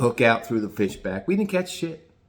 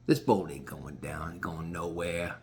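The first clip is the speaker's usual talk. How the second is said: gruff Gross; 8 semitones lower, 8 dB quieter than usual. Retold or imitated. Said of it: "Gross goes".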